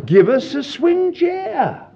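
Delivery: slow, high appeal to the Lord; mock-earnest plea to heaven; pleading prayer.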